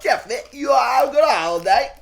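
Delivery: very high, loud hammed-up British; over-the-top British accent; mock British accent. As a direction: shriek it like a silly Brit. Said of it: "Does that sound like British?".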